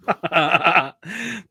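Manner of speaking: loud, real laugh at a joke; helpless reaction laugh; burst of laughter. Real, not acted.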